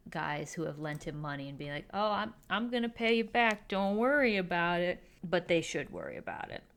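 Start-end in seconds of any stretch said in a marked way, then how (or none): 1.9-5.0 higher, breezy mock reassurance; placating. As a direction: brush off their worries, pitched up. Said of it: "he is placating all of".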